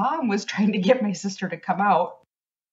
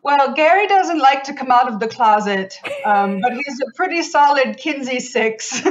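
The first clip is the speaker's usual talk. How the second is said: loud, high mid-century mid-Atlantic parody; mid-Atlantic accent; 5 semitones higher, 4 dB louder than usual. Retold or imitated. Sibling mimics sister describing her ghost, mid-Atlantic accent. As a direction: play up an old-time mid-Atlantic accent, projected. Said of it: "mid-Atlantic, mid-century accent thing".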